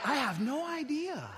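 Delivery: drawn-out mock cluelessness; feigned innocence. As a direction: play dumb with exaggerated innocence. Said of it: "you can lie".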